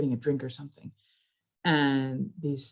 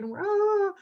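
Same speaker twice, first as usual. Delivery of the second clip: scared yelp. Retold or imitated. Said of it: "we're scared and yelling it".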